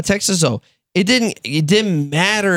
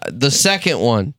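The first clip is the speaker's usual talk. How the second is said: ordinary talk, nothing marked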